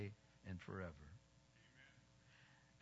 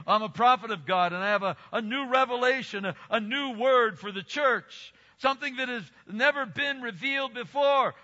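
loud, raised-pitch imitation prophet; self-proclaimed prophet; 6 semitones higher, 8 dB louder than usual. Preacher imitating someone claiming a new revelation, loud proclamation.

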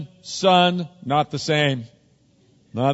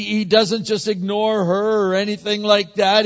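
ordinary delivery

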